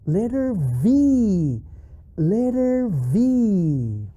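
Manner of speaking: slow, stretched "Letter V"; drawn-out presentation. Shown as a demonstration. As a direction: hold the V long, as if unveiling it. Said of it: "we have a special letter".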